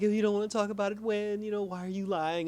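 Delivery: high, pushy confrontation; mock angry girlfriend. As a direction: press him hard, voice up high. Couple arguing, she confronts him.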